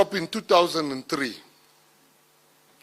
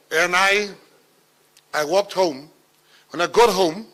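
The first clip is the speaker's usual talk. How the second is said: ordinary talk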